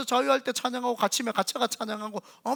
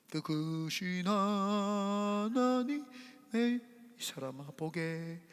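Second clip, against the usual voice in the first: softer, slow gentle invitation; calm, gentle; 10 dB quieter, slower than usual. Retold or imitated. Spouse calmed by soft music, gently inviting a talk. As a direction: coax the spouse into talking quietly. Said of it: "이렇게 나가지 않겠냐".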